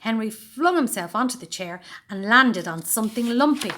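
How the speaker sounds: ordinary delivery, nothing marked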